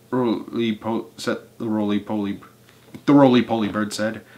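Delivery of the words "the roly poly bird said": plain reading voice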